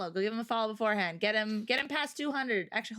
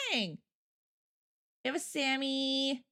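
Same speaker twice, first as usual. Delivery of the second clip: usual speaking voice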